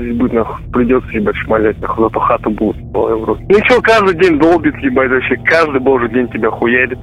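ordinary talk

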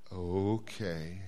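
low, drawn-out sheepish "Yeah". Acted. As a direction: answer like you've been caught. Mike concedes to God.

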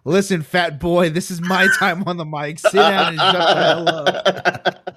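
mock heel putdown ending in a laugh; heel taunt. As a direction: bark it at the heckler. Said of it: "fan that was yelling".

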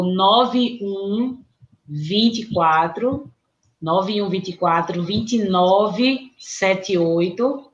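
slow, deliberate number reading; slow dictation. Real, not acted. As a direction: spell each digit out slowly.

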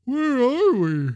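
loud, high, drawn-out yawning words; mid-yawn. A narrator's reading. Waking Sam asks Jack where they are, mid-yawn. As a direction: stretch the question right through a big yawn. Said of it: "mid-yawn".

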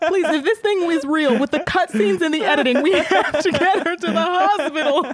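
high-pitched reading through giggles; laughing. Real, not acted. Host reading silly comments aloud, real laughter. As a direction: struggle to read it out while cracking up.

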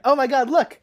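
loud, high, startled exclamation; surprised. Acted. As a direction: blurt it out as you spot them. Spotting the rats suddenly.

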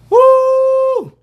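high, loud excited whoop. Real, not acted. Host reacting to the news with a high whoop. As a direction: let out a big hype cry.

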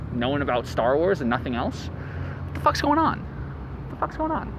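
ordinary talk

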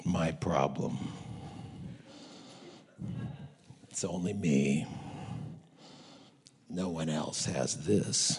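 gloomy low voice. Retold or imitated. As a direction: mope it out deep and soft. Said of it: "first time in".

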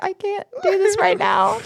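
high, laughing retold protest; holding back laughter. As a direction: reenact it while breaking into giggles. Retelling struggle not to laugh.

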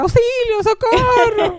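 hammed-up call for rescue, played for laughs; grito de auxilio imitado. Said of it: "Y el otro lado era como que". (grito agudo de socorro, exagerado)

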